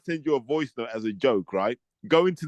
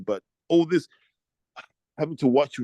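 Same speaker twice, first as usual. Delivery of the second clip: normal talking voice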